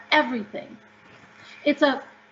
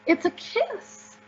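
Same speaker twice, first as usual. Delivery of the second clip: high, slow, bright-eyed reply, softer; delighted correction; 10 semitones higher, 4 dB quieter, slower than usual. Retold or imitated. Telling her friend the X is a kiss, high and slow. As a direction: lift it up like a happy secret, slowly. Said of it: "And I looked at her and I said".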